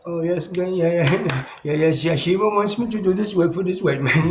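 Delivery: high, loud, eager acted voice with laughs; hammed-up eager servant; mock Indian servant voice. Acted quote of Indian slaves.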